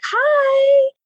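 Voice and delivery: squeaky high chirpy hi; a stranger eagerly greeting passersby, very high; high excited greeting. Retold or imitated. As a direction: chirp a delighted hello way up high. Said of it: "was like".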